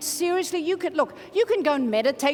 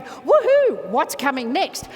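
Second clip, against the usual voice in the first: gleeful anticipation; 4 dB louder, slower than usual. Acted. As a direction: whoop it, up for anything. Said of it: "Let's change it to going".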